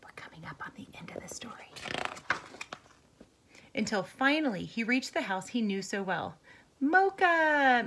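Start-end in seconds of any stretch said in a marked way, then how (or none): 0.0-1.7 whispering. Real, not acted. Teacher aside. 6.8-7.8 loud high call; shouting. A narrator's reading. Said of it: "Michelle shouted".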